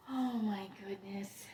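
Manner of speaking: real gasp, then slow, hushed amazement; awed whisper. Real, not acted. Collector seeing an orchid she has coveted, in an awed whisper. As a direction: whisper it slowly, overcome with delight. Gasps at a long-wished-for orchid.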